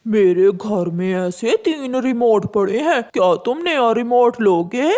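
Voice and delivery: put-on wicked crow luring a child; sly crow. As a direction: tempt the child sweetly.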